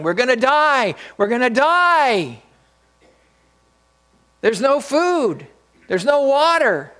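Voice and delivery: high, loud, panicky scream of a castaway; panicked yelling. Retold or imitated. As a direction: yell it like a man who thinks he'll drown. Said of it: "started screaming and yelling".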